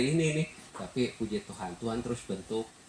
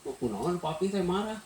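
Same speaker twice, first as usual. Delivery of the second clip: higher childlike question, half-mocking; imitating child; 8 semitones higher than usual. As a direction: act the puzzled child asking dad. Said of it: "negor ke anak".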